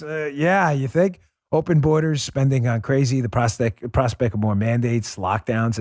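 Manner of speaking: host reading a paid ad to his audience. Acted. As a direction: sell the product to the listeners. Reads sponsor ad.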